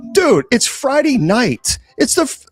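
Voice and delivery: high, mocking scoff at the trolls; a teasing high-pitched sneer at idle trolls. Streamer mocking trolls in a high teasing voice.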